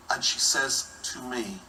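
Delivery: ordinary talk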